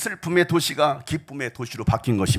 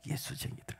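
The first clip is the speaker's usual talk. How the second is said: mocking whisper; 14 dB quieter than usual. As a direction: whisper 예수쟁이들 with scorn. Repeated mocking label. (예수쟁이들을 비웃듯 속삭이기)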